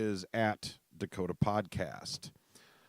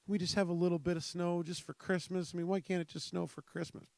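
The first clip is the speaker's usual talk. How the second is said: wishful pleading; 8 semitones higher than usual. Retold or imitated. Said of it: "we were sitting there going".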